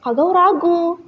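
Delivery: plain reading voice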